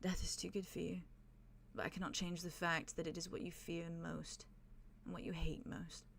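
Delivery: softly spoken, emotionless verdict. Acted. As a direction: hush it like a final verdict. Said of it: "he very softly just says".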